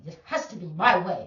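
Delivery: loud mocking demand; imitating a bully's insistence, loud. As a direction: bark out a childish demand. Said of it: "it was literally like that".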